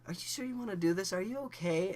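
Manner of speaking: put-on high, loud girly voice; imitated girlfriend. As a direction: put on a high voice to check on him.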